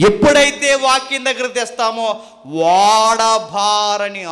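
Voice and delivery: loud, rhythmic sermon with stretched words; forceful preaching. Real, not acted. Preacher urging them to be like the tree, forceful preaching. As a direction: hammer out each command to the congregation. Exhorts congregation.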